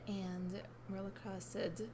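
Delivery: ordinary talk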